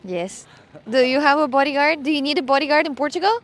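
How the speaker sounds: ordinary talk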